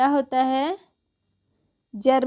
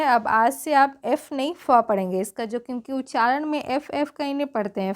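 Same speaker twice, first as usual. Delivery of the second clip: ordinary delivery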